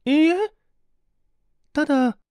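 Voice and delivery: high, loud flustered denial; flustered young woman. A narrator's reading. The young woman hastily denies being engaged, flustered and high. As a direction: blurt it out caught off guard.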